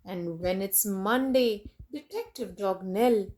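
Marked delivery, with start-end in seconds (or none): none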